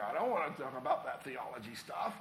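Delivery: a hint of a whiny skeptic waving off the sermon; reluctant listener. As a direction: lightly voice a reluctant churchgoer brushing off theology. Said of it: "some of you are like".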